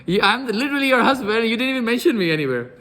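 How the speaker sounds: high, loud comic protest, laughing; mock indignation. Overlooked husband to his wife, laughing mock indignation.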